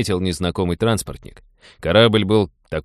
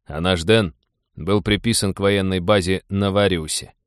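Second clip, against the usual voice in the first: usual speaking voice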